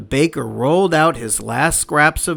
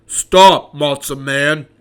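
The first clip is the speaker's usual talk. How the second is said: a mild cry of stop, louder on stop; cried out; 4 dB louder, slower than usual. Acted. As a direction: call after the runaway matzah. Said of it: "cried Mister Cohen".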